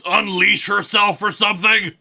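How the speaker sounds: doubting hero in a put-on voice; Batman. Batman disputing the accusation against Hal Jordan, sceptical put-on hero voice. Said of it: "Batman is the one who doesn't buy it".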